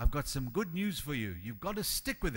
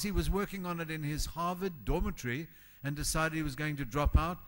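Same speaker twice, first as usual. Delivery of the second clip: ordinary delivery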